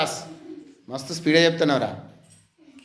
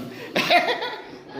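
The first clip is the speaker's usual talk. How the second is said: airy high laugh; 12 semitones higher, 3 dB quieter than usual. Real, not acted. Teacher laughs at the answer.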